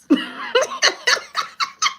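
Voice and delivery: high-pitched burst of real laughter. Real, not acted. Reader cracking up at her own card joke, high cackle.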